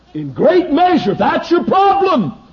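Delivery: high, loud and slow; forceful preaching. Real, not acted. Preacher telling the congregation their problem, loudly. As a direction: drive it home loudly. Preacher driving the point home.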